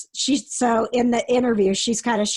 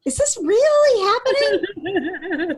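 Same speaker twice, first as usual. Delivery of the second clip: incredulous laugh; 10 semitones higher, slower than usual. Retold or imitated. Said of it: "constant conversation of".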